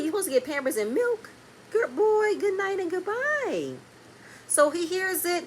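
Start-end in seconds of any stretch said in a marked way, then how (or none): 1.7-3.7 mocking sendoff.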